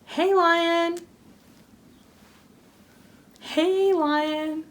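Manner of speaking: high, sing-song call to a lion. Pretend. Teasing a lion with a bone.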